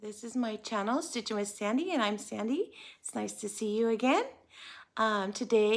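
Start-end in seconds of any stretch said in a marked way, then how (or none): none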